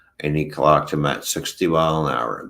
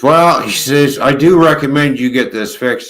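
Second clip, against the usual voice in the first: booming, higher retold cop voice; loud cop quote; 9 semitones higher, 11 dB louder than usual. Inspector telling the trucker to fix his light, loud and higher. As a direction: bellow it like the cop laying down the law.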